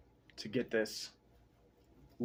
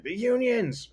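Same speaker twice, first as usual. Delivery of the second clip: Kamusha voiced; 11 semitones higher, 4 dB louder, slower than usual. Voicing Kamusha in a retold story.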